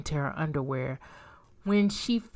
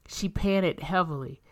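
ordinary delivery, nothing marked

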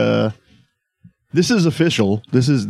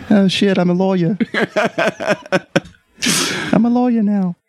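much higher, laughing falsetto; 11 semitones higher, slower than usual. Acted. [name] discovers he's now a lawyer, high laughing falsetto. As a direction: squeal it through laughter like Elle Woods. Turned into Reese Witherspoon lawyer.

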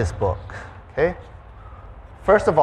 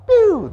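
high, loud single pew; one sharp firework shot; 7 dB louder than usual. Retold or imitated. Mimicking Roman candle shots.

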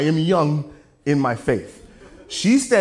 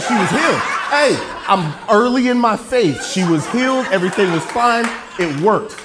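loud, higher comic protest; jokingly indignant self-defence; playfully defensive; 5 semitones higher, 5 dB louder than usual. Real, not acted. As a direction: insist over the laughter that it counted. Mock-defends himself to laughing audience.

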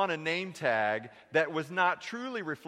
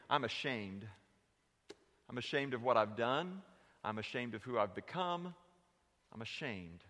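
quiet confession; 4 semitones lower, 6 dB quieter than usual. Acted. Preacher voicing a hurting churchgoer, low and soft. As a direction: lower and softer, heavy with hurt.

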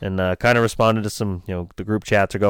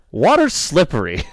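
deadpan sarcasm; 6 semitones higher than usual. Acted. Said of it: "You don't say, Bob".

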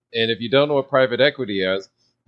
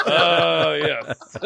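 laughing mock reply; 4 semitones higher than usual. His own stunned reply, laughing.

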